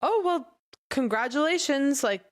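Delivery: high, slow polite congratulations; retold friendly reply, drawn out; trying to be friendly. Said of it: "I wanted to be friendly".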